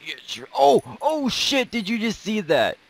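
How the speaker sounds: high, loud, thrilled yelling; excited shout. Real, not acted. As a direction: scream it like you can't believe it. Amazing long shot just landed.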